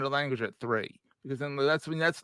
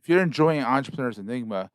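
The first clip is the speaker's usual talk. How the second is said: upbeat announcer read of the outro; podcast outro read; 5 dB louder than usual. Acted. Host reading the podcast outro to listeners, announcer style. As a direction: read it like a polished show sign-off.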